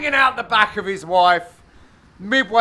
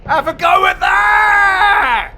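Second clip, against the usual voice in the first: loud, high holler with the last word stretched; mock triumphant shout; 10 semitones higher, 10 dB louder, slower than usual. Commentator voicing Matt sending it skyward, mock triumphant shout. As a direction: bellow it slowly like a huge boast. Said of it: "He's like".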